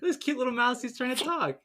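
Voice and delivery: high cooing over a cute creature; mock cooing. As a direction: coo patronisingly in a high voice. Cooing over Stuart Little, acted.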